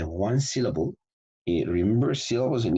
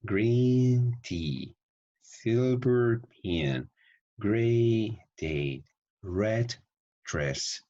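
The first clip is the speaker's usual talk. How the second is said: high, slow stretched vowels, pause after each pair; drawn-out practice demo; 5 semitones higher, much slower than usual. Shown as a demonstration. Teacher models the colour phrases for students, drawn-out practice voice. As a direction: stretch each word out with exaggerated melody. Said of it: "you have to practice like this".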